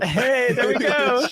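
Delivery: high, loud delighted whoop; excited cheer. Real, not acted. Greeting news of a shared outing.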